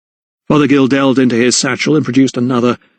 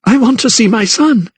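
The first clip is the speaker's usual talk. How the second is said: quivering with emotion; 11 semitones higher than usual. Acted. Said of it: "Her voice was quivering with emotion".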